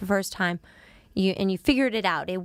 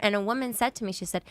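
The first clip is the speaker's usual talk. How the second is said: ordinary talk, nothing marked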